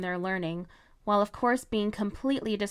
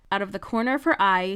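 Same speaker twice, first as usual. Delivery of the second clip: ordinary delivery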